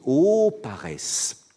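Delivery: slow, loud, solemn declamation; declaimed quote. Retold or imitated. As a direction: proclaim it like an ode. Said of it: "Et il écrit".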